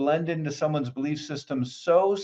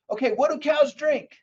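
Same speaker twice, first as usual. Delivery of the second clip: quick priming question; 9 semitones higher than usual. Shown as a demonstration. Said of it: "I said".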